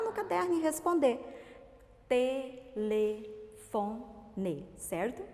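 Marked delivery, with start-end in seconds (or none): 2.1-3.0 "Telefone" slowly, with gaps between syllables. Shown as a demonstration. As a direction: break "Telefone" into four spaced beats. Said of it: "nós vamos falar pausadamente".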